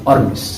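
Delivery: आर्मिस with a clear final s; आर्मिस said ar-mis; Hermès as ar-mis with final s; brand name pronounced. Shown as a demonstration. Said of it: "आर्मीज नहीं पढ़ना".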